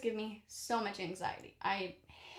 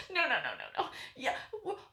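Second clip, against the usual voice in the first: half laughing, mock panicked no's; 3 semitones higher, 3 dB quieter, faster than usual. Retelling the loose screw, laughing mock panic. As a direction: laugh through the dread.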